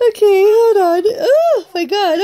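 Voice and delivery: breaking up with laughter; can't get through the words for laughing; laughing hard. Real, not acted. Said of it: "I can't help but laugh".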